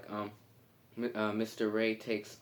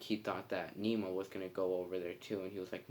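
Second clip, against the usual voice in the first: ordinary talk, nothing marked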